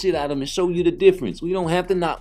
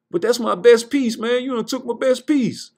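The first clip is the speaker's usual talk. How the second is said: high-pitched mock complaint; protesting player; 6 semitones higher than usual. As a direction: whine in protest as your best piece gets taken. Acted protest over lost chess piece.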